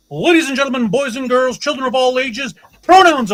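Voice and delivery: loud, high, booming showman intro; ring announcer. Pretend. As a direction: welcome the crowd like a ring announcer. Announcer-style show opening.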